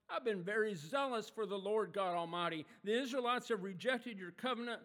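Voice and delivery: loud, indignant reading of his complaint; angry prophet. Acted. Elijah protesting to God, loud and angry. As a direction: read it like a prophet at the end of his rope. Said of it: "you can almost hear the anger in his voice".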